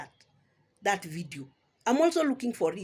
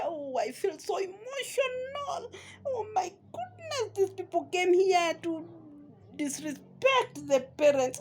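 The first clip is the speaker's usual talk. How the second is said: very high, slow, wailing imitation; hammed-up tearful squeal, words dragged out; mock sobbing; 9 semitones higher, slower than usual. Vlogger mocking the guest's crocodile tears, mock sobbing.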